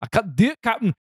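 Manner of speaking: high, strained mock Scottish; Scottish attempt sliding into weird voice, laughing; weird Scottish accent. Shown as a demonstration. Pitching the gorilla for sale, hammed-up Scottish accent. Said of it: "turned into some weird voice".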